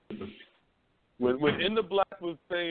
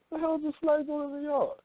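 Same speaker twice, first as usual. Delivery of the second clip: retold disbelief, pitched higher; a young man thrown by a flag in his own yard; incredulous; 10 semitones higher than usual. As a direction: act baffled at the flag. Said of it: "and I'm like".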